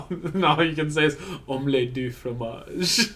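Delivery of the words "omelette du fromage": slow, hammed-up French accent. Mocking stroke victim who speaks only French, in a French accent. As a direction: drawl it out like a cartoon Frenchman. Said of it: "you're French".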